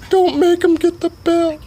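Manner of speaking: squeaky high warning voice; hammed-up high-pitched drunk buddy; high mock warning. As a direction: warn him in a silly high voice. Sugar Tits voiced high.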